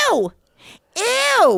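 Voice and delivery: mother recoiling at the photos; mom's disgusted ew. Retold or imitated. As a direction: squeal it in mock disgust. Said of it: "and she goes".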